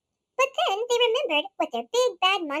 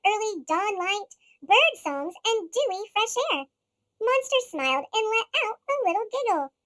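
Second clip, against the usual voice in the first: ordinary delivery, nothing marked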